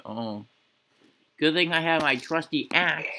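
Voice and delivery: normal talking voice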